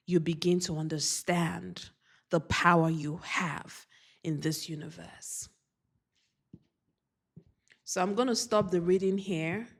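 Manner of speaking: ordinary talk, nothing marked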